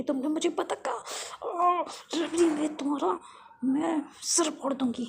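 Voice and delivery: acted indignant outburst; outraged threat. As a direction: threaten in a play-acted huff. Outraged threat after name-calling.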